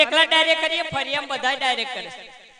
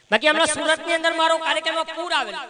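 ordinary delivery

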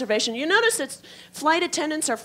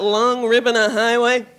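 fading drive call; 7 dB louder, slower than usual. Acted. Dreamy highway longing.

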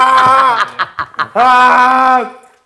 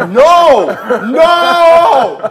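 real laughing shouts of no, pitched up and loud; 8 semitones higher, 4 dB louder, much slower than usual. Real, not acted. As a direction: yell no over and over through real laughter. Laughing protest at friend.

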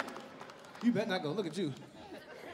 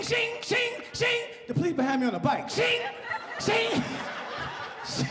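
mock siren; 10 semitones higher than usual. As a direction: imitate a bike siren chasing you. Imitating police bike siren.

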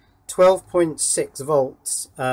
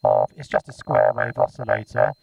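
robotic vocoded speech on a buzzy square-wave tone; vocoder voice; 13 semitones lower than usual. Shown as a demonstration. Maker demonstrating his vocoder's oscillator, robotic vocoded voice. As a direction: talk as a robot through a vocoder. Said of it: "listen to this thing vocode".

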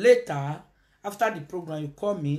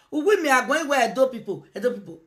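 usual speaking voice

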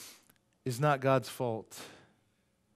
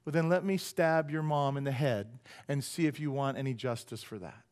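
ordinary talk, nothing marked

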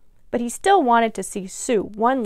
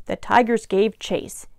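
ordinary delivery

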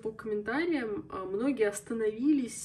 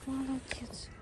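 whispered praise; 5 semitones higher, 3 dB quieter, slower than usual. Real, not acted. Praising the dog.

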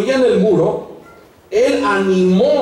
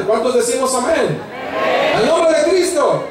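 high, rousing preacher's shout; llamado a la congregación; 8 semitones higher than usual. Real, not acted. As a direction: call the church to answer amen. (grito exaltado de predicador)

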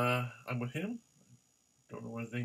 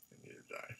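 whisper; 17 dB quieter, much faster than usual. Acted. Whispered aside about tie.

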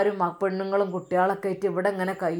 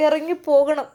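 husband's angry order; 7 semitones higher, 6 dB louder than usual. Retold or imitated. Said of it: "അക്രമങ്ങൾ വന്നപ്പോൾ".

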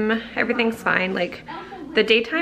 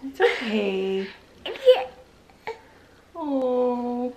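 slow, teary half-laugh; laughing through tears; 3 semitones higher, much slower than usual. Real, not acted. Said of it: "I did not think I would get so emotional".

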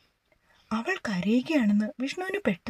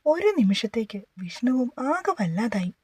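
usual speaking voice

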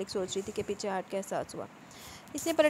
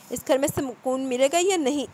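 loud, higher-pitched irritated roar; 4 semitones higher, 6 dB louder than usual. A narrator's reading. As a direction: bellow like someone robbed of sleep. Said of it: "किसी के धाड़ने की आवाज़ आई". (झुंझलाकर दहाड़ते हुए)

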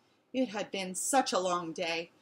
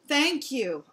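mild, raised howl of thanks; 5 semitones higher, 5 dB louder than usual. A narrator's reading. Dog thanking lady for water, mildly howled. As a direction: call it out a little higher and louder. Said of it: "I howled".

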